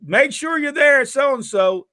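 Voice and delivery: high, drawn-out imitated yell; Jarrett. Retelling a boss hollering after a man in rain, high yell. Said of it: "Jarrett yells at the guy that leaves".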